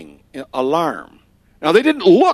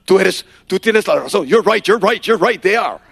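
excited agreement; 3 semitones higher, 5 dB louder than usual. Retold or imitated. Leaders confirming excitedly.

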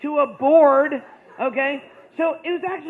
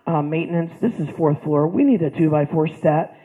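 mock intercom call; 7 semitones lower, 6 dB louder than usual. Nurse imagined calling maintenance.

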